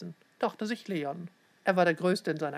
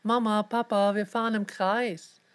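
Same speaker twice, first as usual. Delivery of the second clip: put-on mildly raised, eager boy's call; 3 semitones higher than usual. A narrator's reading. Boy calling to his parents from the back seat, calling out. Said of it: "rief Leon".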